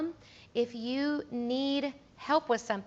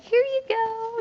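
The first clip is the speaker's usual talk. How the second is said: high, bright-sounding mock cheer, much higher and louder; chirpy handover; 12 semitones higher, 5 dB louder, slower than usual. To the guest leaving the band, a high mock-cheerful handover. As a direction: hand it over with exaggerated cheer. Handing over a thank-you goodie bag.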